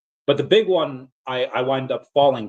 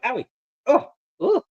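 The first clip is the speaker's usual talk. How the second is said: high, whiny yelps of pain; hammed-up whimpers each time they poke; mock pain yelps; 11 semitones higher than usual. Pained groans as poked.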